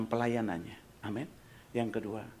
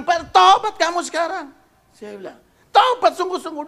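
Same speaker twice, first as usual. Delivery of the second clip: high, loud order to repent; loud command; 16 dB louder than usual. Retold or imitated. Pastor ordering the host to repent, loud command. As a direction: drive it home.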